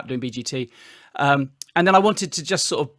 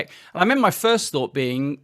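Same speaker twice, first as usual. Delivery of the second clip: usual speaking voice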